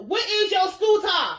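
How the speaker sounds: loud, high, hammed-up demand; mock yell. Father demands to know about son's inhaler, mock yelling. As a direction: imitate an exasperated shouted question. Mimicking the line from a clip.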